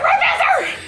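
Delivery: very high, loud squealed yell; urgent mock shout. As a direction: yell it frantically, half laughing, way up high. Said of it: "It was like".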